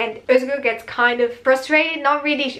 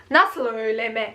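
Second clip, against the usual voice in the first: ordinary delivery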